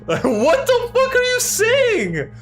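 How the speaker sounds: can't believe what the story just did; laughing disbelief. Real, not acted. Outraged by the plot twist.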